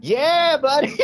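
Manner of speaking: very high, delighted cheer; high-pitched enthusiastic agreement; excited yes. Real, not acted. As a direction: squeal it out, thrilled at being understood. Delighted the other knows the show.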